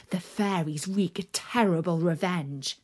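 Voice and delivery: ordinary delivery, nothing marked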